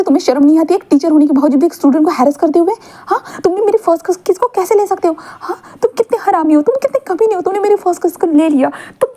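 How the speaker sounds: high, indignant scolding rant; angry yelling. A narrator's reading. Student yelling at teacher who stole his first kiss, high and furious. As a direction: rant at him like a wronged student. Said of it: "wing ऐसे जोर से चिल्लाकर बोलता है".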